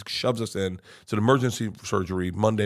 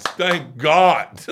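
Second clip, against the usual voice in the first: relieved outcry; 5 semitones higher, 7 dB louder than usual. Retold or imitated. Waiting room cheers the good news.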